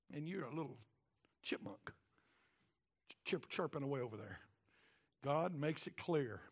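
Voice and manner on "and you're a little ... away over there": soft, mocking aside; mock dismissive. God belittling Job as a chipmunk, quiet and mocking. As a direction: shrink him down with a quiet sneer. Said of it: "God says".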